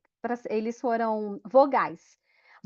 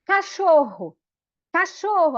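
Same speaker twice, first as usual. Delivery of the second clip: calling out; 4 semitones higher, 5 dB louder, slower than usual. Retold or imitated. Kids calling out the word.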